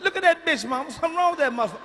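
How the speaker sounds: put-on high kid exclaiming at his auntie; excited kid voice. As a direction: blurt it like a stunned seven-year-old. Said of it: "I said".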